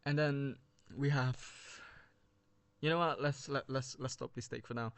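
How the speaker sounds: breathless, running out of air; out of breath. Real, not acted. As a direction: talk while short of breath. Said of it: "I'm running out of breath".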